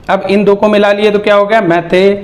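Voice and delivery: ordinary talk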